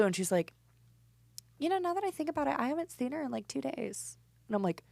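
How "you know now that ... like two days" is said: lightly voiced mom, higher and softer; a hint of a vague, distracted mom; mom's reply. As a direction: mom casually admits she hasn't seen the cat. Mom answering about missing cat.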